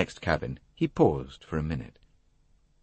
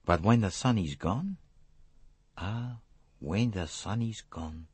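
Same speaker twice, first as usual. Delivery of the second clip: put-on French-accented detective musing, fading softer; Hercule Poirot; 3 semitones higher, 5 dB quieter, slower than usual. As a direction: trail into a riddle, French accent.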